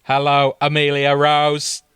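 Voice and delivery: slow, staccato robot-alien voice; each syllable held on a level tone; Dalek. Acted. Dalek friend greets Amelia at the door, mechanical screaming voice. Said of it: "in his tender, screaming voice".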